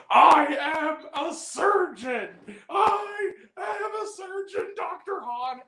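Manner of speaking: high, slow, drawn-out bellow; hammed-up high-pitched declaration; mock dramatic shout. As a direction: proclaim it like an overacting TV actor. Mocking an actor's famous line.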